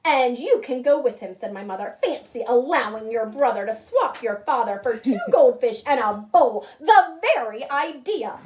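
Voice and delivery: loud, firm telling-off; stern scolding. Acted. Mother ordering her son to fetch his father, loud and stern. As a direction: lay down the law to a naughty son. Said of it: "very mad".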